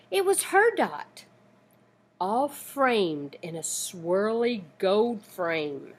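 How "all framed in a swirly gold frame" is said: usual speaking voice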